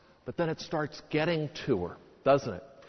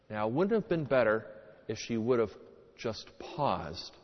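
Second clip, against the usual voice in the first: ordinary talk, nothing marked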